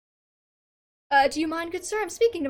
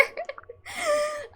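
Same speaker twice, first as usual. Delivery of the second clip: real shrieking laugh; high burst of laughter; 13 semitones higher than usual. Real, not acted. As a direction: crack up high. Streamer laughs after line.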